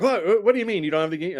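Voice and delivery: imitating the president's amazed what-what-what; incredulous question. President incredulous about no game.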